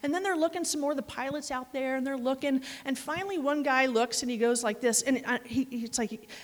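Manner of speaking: ordinary delivery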